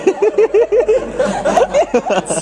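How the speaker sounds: loud, high laughing burst. Real, not acted. Comedian cracking up about love, loud high laugh. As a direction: let a high laugh break out. Laughing at his own story.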